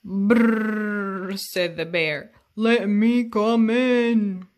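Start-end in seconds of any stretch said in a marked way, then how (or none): 0.0-1.4 bear shiver. Acted. 2.6-4.5 big bear voice. Acted. Bear asks to come in.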